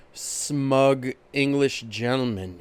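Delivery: ordinary talk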